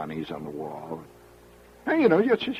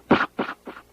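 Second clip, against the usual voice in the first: gunshot noise; 5 dB louder than usual. Retold or imitated. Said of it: "It sort of goes".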